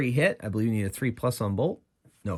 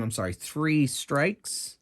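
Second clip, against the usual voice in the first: usual speaking voice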